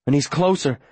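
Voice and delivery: ordinary delivery, nothing marked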